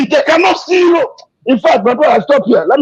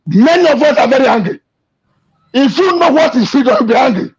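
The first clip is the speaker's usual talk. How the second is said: ordinary talk, nothing marked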